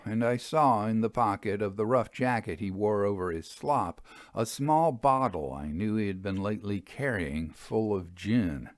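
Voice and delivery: usual speaking voice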